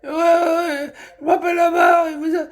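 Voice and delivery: hammed-up, very high caricature voice; mocking imitation. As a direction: mimic him in a silly squeal. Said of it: "Et il parle comme ça".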